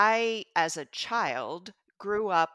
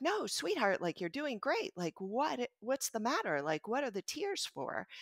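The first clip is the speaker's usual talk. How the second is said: put-on soft, higher comforting voice to a crying child; tender reassurance; 5 semitones higher, 4 dB quieter than usual. Mom soothing her crying daughter in the car, softly and tenderly.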